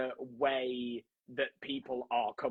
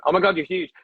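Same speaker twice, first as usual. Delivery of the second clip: loud, shaky attempt at a Kiwi accent; hammed-up New Zealand accent on "huge"; 5 semitones higher, 11 dB louder, faster than usual. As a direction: have a go at the Kiwi accent, loudly. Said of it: "No, he wasn't American. He was Kiwi. I can't do the accent".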